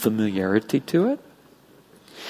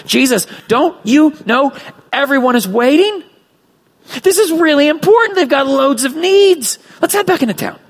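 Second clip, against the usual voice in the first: higher, louder desperate pleading; desperate Peter; 11 semitones higher, 4 dB louder than usual. Acted. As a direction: beg Jesus to come back, frantic. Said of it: "We can sense the desperation in Peter's words".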